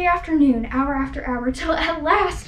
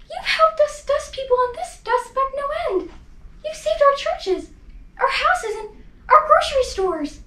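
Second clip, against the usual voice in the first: much higher, small, thankful mayor voice; tiny Who mayor; 9 semitones higher than usual. Acted. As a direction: squeak it up like a tiny grateful mayor.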